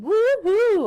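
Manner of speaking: high, loud woohoo; a drawn-out, celebratory cheer. Real, not acted. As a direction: whoop it out high and long. Celebrates that forms are the same.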